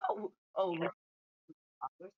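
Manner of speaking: ordinary delivery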